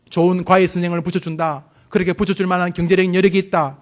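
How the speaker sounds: normal talking voice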